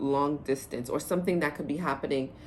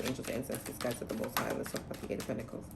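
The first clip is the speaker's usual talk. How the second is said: whispered invocation; 3 semitones lower, 8 dB quieter, faster than usual. Real, not acted.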